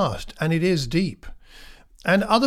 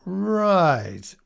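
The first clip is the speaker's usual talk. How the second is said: mock scepticism; 3 semitones higher than usual. Imagined scornful retort to Herod.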